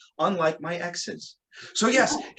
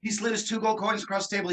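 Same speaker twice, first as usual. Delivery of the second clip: ordinary delivery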